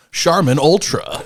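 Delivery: slow high ad voice; ad announcer. Pretend. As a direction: announce it like a commercial. Mock commercial read.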